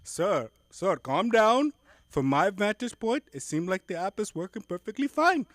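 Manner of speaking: hammed-up Indian call-centre agent; Indian accent. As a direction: play a Mumbai support rep, loud. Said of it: "agent in call".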